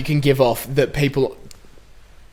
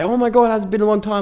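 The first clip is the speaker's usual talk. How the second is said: higher-pitched mock delighted greeting; gushing greeting; 7 semitones higher than usual. Parents greeting someone not seen in ages, mock gushing. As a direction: imitate parents greeting a long-unseen friend.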